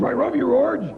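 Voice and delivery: lightly voiced cartoon dog; hint of a cartoon dog talking; Astro from the Jetsons. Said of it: "They're like Astro from the Jetsons".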